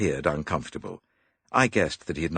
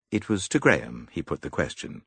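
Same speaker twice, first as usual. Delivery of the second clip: usual speaking voice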